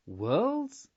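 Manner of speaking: a startled, horrified echo; horrified exclamation. A narrator's reading. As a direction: gasp it out in horror. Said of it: "exclaimed Carmelita, horrified".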